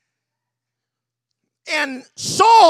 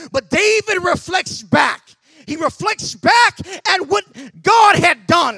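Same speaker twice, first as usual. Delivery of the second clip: rising, louder preaching climax; full-force preaching; 6 semitones higher, 3 dB louder than usual. Acted. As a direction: drive it home to the congregation, higher and louder.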